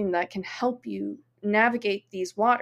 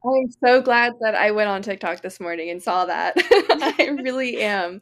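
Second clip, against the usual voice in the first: talking through real laughter; laughing; 6 dB louder than usual. Real, not acted. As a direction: laugh as you speak, delighted.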